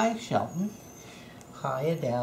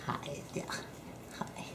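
whisper; 15 dB quieter than usual. Real, not acted. Greeting pets softly.

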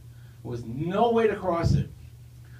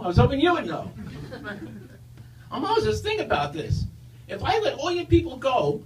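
high squeaky Stooges voice; Curly voice; 6 semitones higher than usual. Acted. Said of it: "high-pitched voice that sounds a little bit like Curly from the Three Stooges".

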